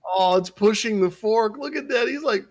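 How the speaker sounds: high, loud, excited squealing. Real, not acted. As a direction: squeal with delight at the video. Excited by the pig video.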